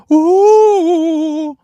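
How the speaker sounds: long high loud ooh; teasing ooh at a diss. Real, not acted. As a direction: react to a savage burn. Reacting to a burn.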